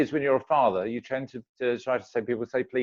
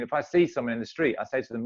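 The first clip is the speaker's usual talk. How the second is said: ordinary talk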